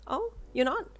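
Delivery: put-on caring older woman asking with concern. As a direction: step out from behind the desk, concerned. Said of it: "she asked with concern".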